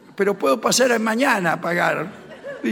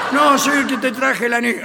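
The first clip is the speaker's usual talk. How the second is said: mock shout; 5 semitones higher, 5 dB louder than usual. Acted scene with the father.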